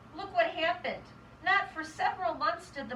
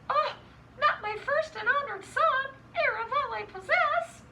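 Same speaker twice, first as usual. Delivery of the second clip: high, dramatic dismay; storybook panic, pitched high; alarmed mother; 10 semitones higher, 5 dB louder than usual. Acted. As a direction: wail it like a horrified mother.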